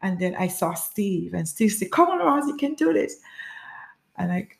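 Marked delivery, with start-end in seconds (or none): 1.9-3.2 high-pitched encouragement; urging her on. Retold or imitated. Steve to a struggling runner, urging encouragement. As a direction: coax her along in a high voice.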